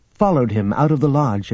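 ordinary delivery, nothing marked

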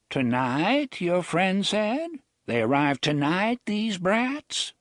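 put-on high, slow, sly old man's voice; a wry old drifter, amused; old gunman Jonas. A narrator's reading. Jonas asking Reynolds about the brats, sly and high.

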